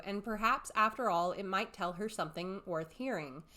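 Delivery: ordinary delivery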